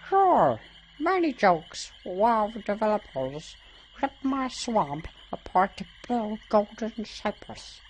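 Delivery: high-pitched swamp creature voice; swamp lurker. Acted. Swamp dweller complaining about developers, in a high lurker voice. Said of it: "like, a swamp lurker".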